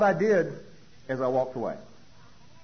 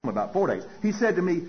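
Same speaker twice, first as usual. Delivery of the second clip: normal talking voice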